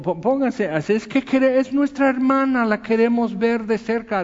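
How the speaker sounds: high, insistent fan complaint; pushy fan protesting. Retold or imitated. Fan in the crowd talking back to the usher, high and insistent. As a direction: whine like a fan who won't step back. Pushing fan arguing with him.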